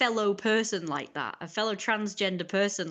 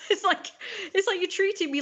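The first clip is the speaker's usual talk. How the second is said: laughing; 10 semitones higher than usual. Real, not acted. Laughs while talking.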